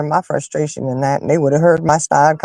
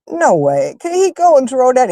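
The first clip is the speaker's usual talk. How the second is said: ordinary talk, nothing marked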